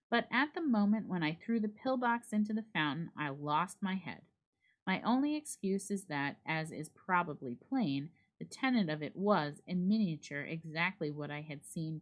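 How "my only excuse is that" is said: plain reading voice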